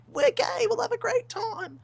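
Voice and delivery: very high, mock-excited woman's voice; gleeful mimicry. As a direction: squeal it as the delighted woman. Imagined woman's gleeful reply.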